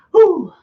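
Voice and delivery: a sharp high nervous blow of breath; nervous whew. Acted. Bracing for a scary video, nervous high whew. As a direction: puff out the tension, high and loud.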